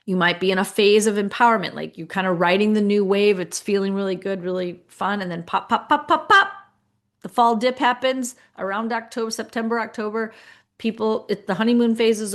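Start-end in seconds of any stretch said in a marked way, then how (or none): 5.4-6.5 rising, louder string of pops; popping firecrackers. Retold or imitated. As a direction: imitate firecrackers going off, building higher and louder. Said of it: "like, firecrackers".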